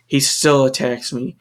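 usual speaking voice

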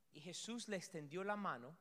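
normal talking voice

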